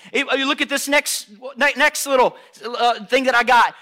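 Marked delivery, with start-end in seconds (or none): none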